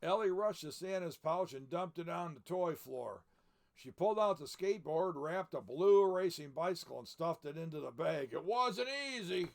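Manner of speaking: lively read-aloud storybook voice; story narration. A narrator's reading. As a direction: read it like a picture book to children. Reads the book aloud.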